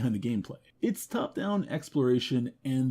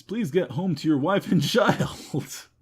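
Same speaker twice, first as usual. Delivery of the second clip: laughing; 2 semitones higher than usual. Real, not acted. Pleading with Mister Weems while laughing. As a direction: crack up partway through.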